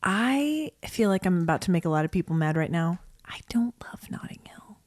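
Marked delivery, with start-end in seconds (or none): 3.2-4.8 hushed, voiceless whisper; whispered confession. Pretend. Admitting a take that angers people, guilty whisper.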